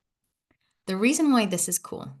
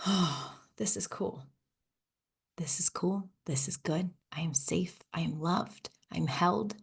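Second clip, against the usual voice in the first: calm affirmations; 7 dB quieter than usual. Acted. Host modeling soft present-moment affirmations, softly whispered. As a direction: breathe it out softly, letting it sink in.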